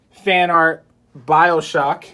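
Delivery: ordinary talk, nothing marked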